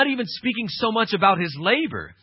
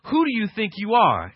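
ordinary talk

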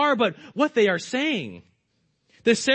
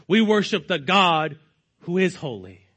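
normal talking voice

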